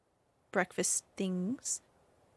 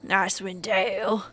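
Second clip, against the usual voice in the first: louder, drawn-out voice of a stable master; haggard stable master; 6 dB louder than usual. Acted. Stable master sizing up a traveler's mule, haggard and drawn-out. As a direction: play a weary stable hand, slowly and firmly. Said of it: "there is a haggard-looking man, the stable master here".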